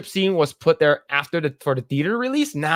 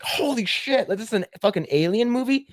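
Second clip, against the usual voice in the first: higher, stunned viewer voice; a viewer floored to find it's an alien movie; mock amazement; 8 semitones higher than usual. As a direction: gasp it out like a shocked moviegoer.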